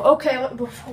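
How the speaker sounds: ordinary delivery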